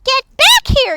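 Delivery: high, loud command; urgent little leader's shout; yelling. A narrator's reading. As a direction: yell after a fleeing crowd. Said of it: "yelled Pip".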